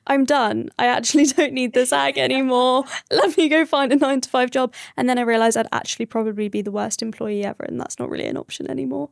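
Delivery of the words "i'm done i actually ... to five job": louder, half-laughing, fed-up declaration; mock exasperated outburst. To the founder guest, acting fed up with emails, mock loud outburst. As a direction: throw up your hands and announce you quit, giggling.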